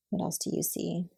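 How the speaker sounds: ordinary delivery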